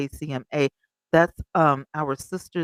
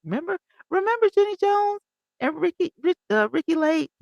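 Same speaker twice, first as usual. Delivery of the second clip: high excited recall; 13 semitones higher than usual. Real, not acted. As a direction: voice jumps high remembering. Recalling old talk show hosts.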